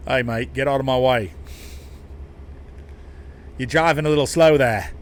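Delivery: loud, badly faked Aussie accent; terrible Australian accent. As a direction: call it out in a hammy Australian accent. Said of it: "My Australian accent is ruined".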